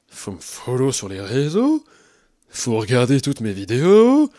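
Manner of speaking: slow, low nagging plea; annoying influencer. Acted. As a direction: drag it out, pushy. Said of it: "Un peu agaçant ce perso".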